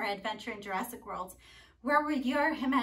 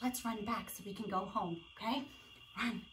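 soft, low-key playful plotting; lowered voice; hushed suggestion; 3 semitones lower, 10 dB quieter than usual. Pretend. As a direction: say it quietly, as if still in hiding. T-Rex out of sight.

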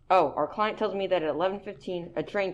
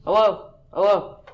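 loud phone hello; 2 semitones higher, 8 dB louder than usual. Acted. Crossed line, man answers loudly.

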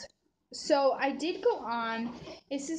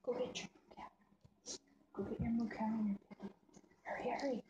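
whispering; 9 dB quieter, much faster than usual. Real, not acted. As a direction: whisper it, urging softly. Whispering to toddler off camera.

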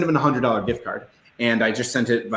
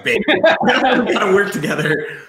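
laughing; 10 semitones higher, 4 dB louder, slower than usual. Retold or imitated. Telling wife they should team up.

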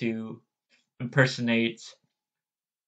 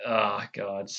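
ordinary talk

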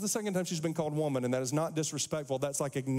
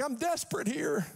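desperate; 9 semitones higher than usual. Retold or imitated. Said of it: "But she's like".

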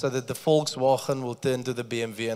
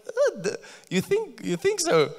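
put-on much higher, timid child's voice; hesitant little girl; 9 semitones higher than usual. Daughter doubting she can preach, high child voice. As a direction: play the unsure young daughter. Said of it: "She's like".

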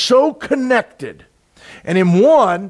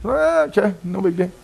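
lightly voiced easygoing dad, trailing soft; chill dad; 2 semitones higher, 4 dB quieter than usual. Preacher imitating his laid-back dad, lightly voiced and unbothered. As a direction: shrug it off like nothing matters. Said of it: "He does not get mad".

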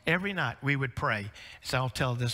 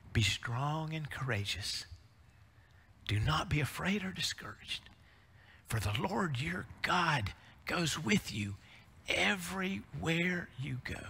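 put-on soft, slow blessing over a baby; tender scripture quote; 2 semitones higher, 5 dB quieter than usual. Grandfather quoting scripture over the crib, soft and slow. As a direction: recite it gently to a grandson. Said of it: "I'll quote that scripture".

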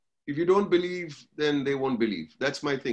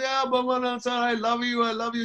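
lightly voiced little girl, higher; a hint of a sweet child saying she loves you; high, innocent little girl reply; child's voice; 9 semitones higher than usual. Voicing the special child.

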